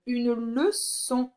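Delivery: une leçon drawn out as une le-çon; slow sounding-out. Shown as a demonstration. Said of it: "c'est cédille devant le O".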